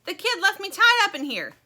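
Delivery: high, blaring complaint; very high, loud indignant character voice; bugle voice. A narrator's reading. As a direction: yell it like a bugle. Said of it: "The bugle voice comes again".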